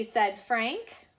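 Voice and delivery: usual speaking voice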